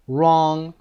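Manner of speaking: wrong said rong, loud; silent W. Shown as a demonstration. Teacher modelling the correct wrong. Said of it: "دبلیوش خونده نمیشه".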